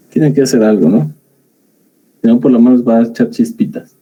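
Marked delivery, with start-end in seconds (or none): none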